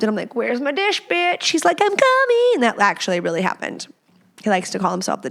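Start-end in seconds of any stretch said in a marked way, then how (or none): 0.3-1.5 higher-pitched teasing call; re-enacted household holler; mock calling out. 1.8-2.5 very high sing-out reply; hammed-up high-pitched answer; mock calling back. Husband's answer, re-enacted.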